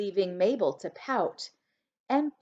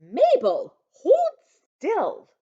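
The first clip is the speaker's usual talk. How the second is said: mother's shout; 11 semitones higher, 9 dB louder, slower than usual. A narrator's reading.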